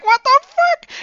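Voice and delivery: shrieking high and loud in disbelief; a fan yelling at a wild plot turn; stunned excitement. Retold or imitated. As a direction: blurt it out, floored by the twist. Reader reacting to surprise twist.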